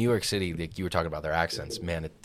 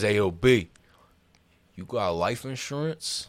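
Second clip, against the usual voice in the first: New York hustler; 3 semitones higher, slower than usual. Retold or imitated. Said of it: "leans over to me and says".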